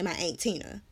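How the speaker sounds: Aunt with a long A, like "ain't"; family pronunciation of aunt. Shown as a demonstration. Naming a family member, Aunt said as "ain't". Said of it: "a person in my family, I say".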